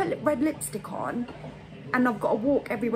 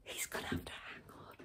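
whispering; 17 dB quieter than usual. Real, not acted. Whispering backstage during bingo.

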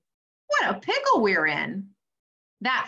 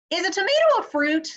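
high, loud, fast question bursting out; eager blurt; 11 semitones higher, 5 dB louder, much faster than usual. Acted. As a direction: blurt it out too eager to wait.

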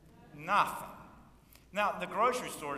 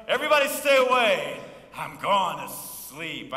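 mock Elijah; 3 semitones higher, 12 dB louder than usual. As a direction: bark it, then drag it off to sleep. Elijah voiced shutting cave door.

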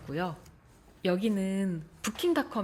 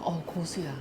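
mouth full of hot food; 3 semitones lower, 6 dB quieter than usual. Real, not acted. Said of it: "아, 뜨거".

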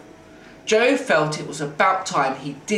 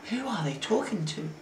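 hushed, higher voice; soft, confidential undertone; whispered question; 4 semitones higher, 8 dB quieter than usual. A narrator's reading. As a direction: ask it softly so they don't hear. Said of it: "whispered Lauren".

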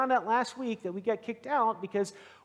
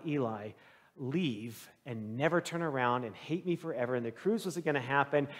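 quiet dread; 5 semitones lower, 4 dB quieter than usual. Real, not acted. Recalling overwhelming fear.

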